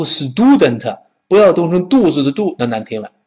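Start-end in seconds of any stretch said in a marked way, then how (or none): 0.0-1.0 student as "si-du-den-te", added vowels; overdone Chinese-syllable student; mispronounced student. Shown as a demonstration. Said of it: "但是不要读student". (错读 student，像“肚”)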